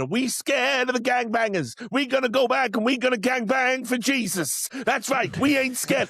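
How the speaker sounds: high-pitched impression of a church preacher; rally the congregation like a fired-up preacher; imitated sermon call-and-answer, pitched well up; rousing preacher. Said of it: "And the preacher's there, and he's going".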